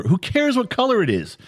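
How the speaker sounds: high, incredulous scoff at a silly question; exasperated. Real, not acted. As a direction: brush the question off in disbelief.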